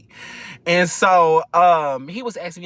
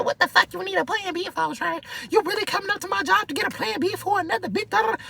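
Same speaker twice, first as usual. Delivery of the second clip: high voice; faster than usual. Retold or imitated. Girl at desk confronts him.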